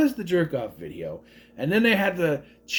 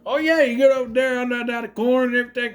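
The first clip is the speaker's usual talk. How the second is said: higher, louder imitation of a Southern relative; mock Southern drawl; 9 semitones higher, 4 dB louder than usual. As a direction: ham up a rural Iowa twang. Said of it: "relatives who sound like they should be from Texas".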